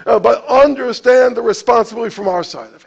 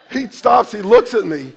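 normal talking voice

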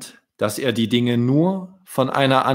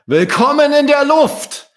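high, loud joyful welcome; cheerful call; 8 dB louder than usual. A narrator's reading. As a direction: welcome them into the sky with joy. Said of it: "rief Sven fröhlich".

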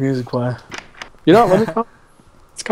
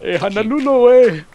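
higher, slow hammed-up island voice; mock Hawaiian; 7 semitones higher than usual. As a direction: play a laid-back Hawaiian.

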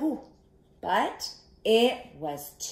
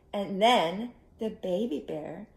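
ordinary delivery, nothing marked

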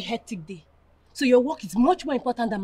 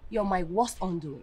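low, soft and bitter; quiet, damning verdict; cold contempt; 4 semitones lower, 8 dB quieter than usual. Acted. Woman dismissing her boyfriend, quietly contemptuous. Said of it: "Why are you sounding this way?".

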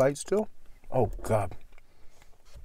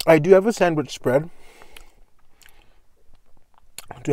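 talking while chewing. Real, not acted. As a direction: mouth partly full of food. Seen 2: Bite at -1.0; sandwich held at lips, jaw working throughout.

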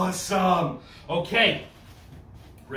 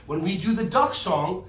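ordinary talk

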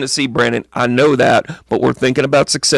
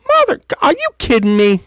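high-pitched comic exasperation; exasperated disbelief; 12 semitones higher than usual. Real, not acted. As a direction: squeal at your own blunder. Finds own stereo button mistake.

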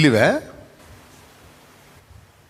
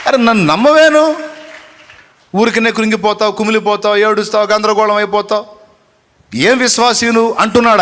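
high, loud, driving sermon cry; preaching at full force; 7 semitones higher, 4 dB louder than usual. Acted. Preacher urges the downcast congregation to endure, in a loud preaching cry. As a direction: hammer each command home to the crowd. Preacher rebukes the faint-hearted.

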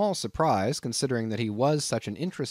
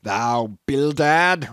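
slow, loud roar of outrage; 3 semitones higher, 6 dB louder, much slower than usual. A narrator's reading. Peleg erupting at Bildad, roaring. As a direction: roar the name at your partner. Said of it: "roared Peleg".

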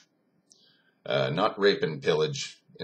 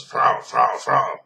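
loud, insistent repeated call, a little higher; barked demand; 7 semitones higher, 8 dB louder, much slower than usual. Retold or imitated. Russian soldier demanding women from a German man, barked demand. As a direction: punch each word like a soldier demanding.

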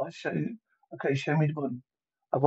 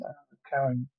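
ordinary talk, nothing marked

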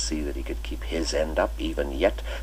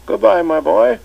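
put-on much higher and louder, playful old schoolmaster; twinkling old master; 12 dB louder than usual. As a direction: cap their joke with a merry invitation to tea. Chips farewell at door.

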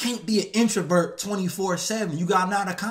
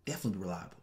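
proper interview voice; 13 semitones lower, 14 dB quieter, much faster than usual. Retold or imitated. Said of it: "But if you".

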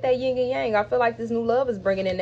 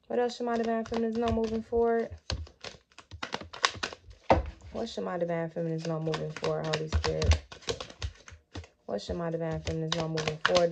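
low, hushed prayer to the Holy Spirit; murmured prayerful request; quiet invocation; 4 semitones lower, 7 dB quieter than usual. Acted. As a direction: softly call on the spirit. Asks Holy Spirit for guidance.

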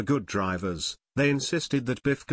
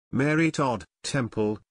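ordinary delivery

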